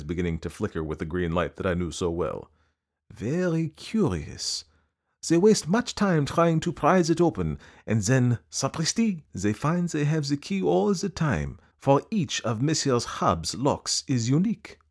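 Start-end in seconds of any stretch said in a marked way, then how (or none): 3.1-14.8 higher, slow, savouring Poirot; Poirot turning over the mystery of the lock, slow and pleased; Poirot musing. A narrator's reading. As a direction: let the detective relish the puzzle.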